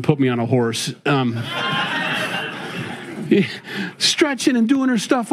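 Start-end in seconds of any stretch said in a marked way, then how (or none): 1.5-3.9 breathy high laugh, almost silent; real laugh. Real, not acted. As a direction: laugh it off at the edit joke. Laughs at being edited onto a horse.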